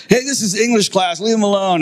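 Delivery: put-on high-pitched girl student objecting; imitated classmate sticking up for him; protesting classmate. Classmate defending him, retold.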